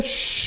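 soft imitated shush. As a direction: hush a woman like the disciples. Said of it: "Diciéndole a una mujer". (chistido suave a una mujer)

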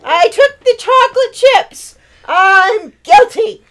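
loud, high, slow theatrical confession; dramatic girl confessing. Acted. Hope confessing to taking the chocolate chips, loud and theatrical. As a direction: overplay the guilt.

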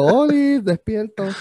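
hammed-up bulb waking up; silly light bulb voice.

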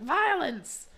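loud, high mock cry; Edith's outcry. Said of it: "poked her head in and was like".

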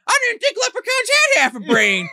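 very high, screechy mock retort; mock fairy comeback. Fairy insults the leprechaun back, high mock indignation.

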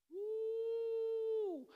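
high, sustained "woo"; drawn-out woo. Real, not acted. Preacher exclaims to congregation, long high woo. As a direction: let out a long, high whoop.

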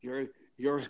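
high, halting indignant accusation in an impression; Jimmy Stewart. Podcaster imitating the actor accusing two murderers, high indignant impression. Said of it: "to hear Jimmy Stewart say".

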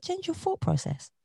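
high, soft advice line; gentle advice. Retold or imitated. Advising someone who is having a bad day, high and soft. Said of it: "So I said".

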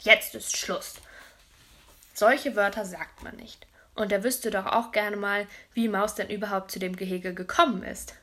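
plain reading voice

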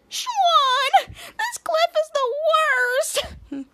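very high, slow put-on wail; wailing complaint. Mandy stuck on the cliff, high slow wail. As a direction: stretch it into a high mock whine.

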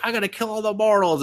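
put-on high, dim little-girl voice; childish demon girl. Demon queen announces her goal, high and childish. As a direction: play it like a ditzy kid. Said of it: "she's like".

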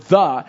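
isolated, emphatic "the"; stress on "the". Shown as a demonstration. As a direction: hit the word "the" hard. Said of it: "emphasizes that right there, that word".

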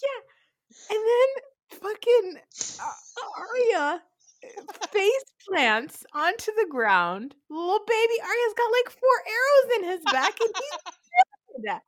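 high, loud, drawn-out wail of a retelling; very high-pitched, slow, upset telling; tearful laughing distress. Real, not acted. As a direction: tell it half laughing, half crying. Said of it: "it's still really sad".

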